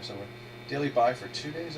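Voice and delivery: ordinary delivery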